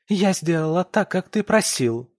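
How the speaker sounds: angry wife blurting at her husband; angry outburst. Acted. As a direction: spit it out in rage. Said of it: "выпаливает со злостью".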